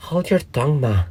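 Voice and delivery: put-on high-pitched young crab retort; a little snappish. A narrator's reading. Young crab talks back to her mother, a little snappish. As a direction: answer back pertly, pitched well up. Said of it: "a little snappishly".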